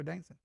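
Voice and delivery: "dancing" as dancin', g dropped; Southern pronunciation. Shown as a demonstration. Trader repeats the local way, "dancing" softly as dancin'. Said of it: "We say dancing".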